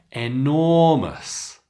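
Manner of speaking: enormous with nor drawn out slowly; enormous, nor slowed and lifted; stress on nor. Shown as a demonstration. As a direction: stretch the middle of enormous. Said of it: "you can hear where I'm stressing".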